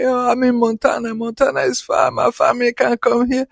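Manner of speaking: high, plaintive retold wail; imitated crying. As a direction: mimic a homesick student crying, pitched high. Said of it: "She would cry".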